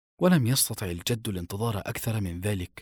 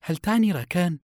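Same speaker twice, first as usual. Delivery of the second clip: high, rising doubtful question; acted skepticism, voice lifted; 9 semitones higher, 4 dB louder than usual. A narrator's reading. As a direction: ask it with suspicion, pitched up. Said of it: "قالت ابنته بشك".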